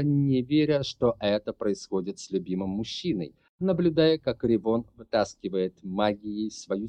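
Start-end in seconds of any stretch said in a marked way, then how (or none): none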